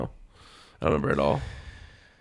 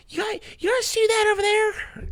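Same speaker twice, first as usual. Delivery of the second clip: put-on high, slow old lady voice; Italian grandma voice. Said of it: "I can't do, like, an Italian grandma, old person voice".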